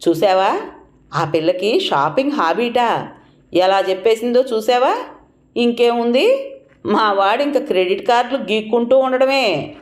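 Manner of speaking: plain reading voice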